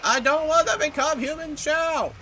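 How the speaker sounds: high screaming panic; being chased. Real, not acted. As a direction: shriek while running for your life.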